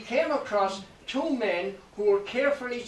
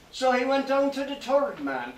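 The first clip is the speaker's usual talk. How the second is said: usual speaking voice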